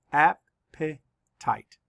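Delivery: very slow, AP-pe-tite split into syllables. Shown as a demonstration. Teacher counting syllables for the class, slow and split. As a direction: break Appetite into slow beats. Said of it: "Let's count the syllables".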